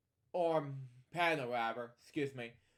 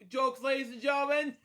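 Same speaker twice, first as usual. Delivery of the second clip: mock announcer; 7 semitones higher, 5 dB louder than usual. Mock announcing a joke to viewers.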